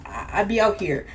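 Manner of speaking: ordinary delivery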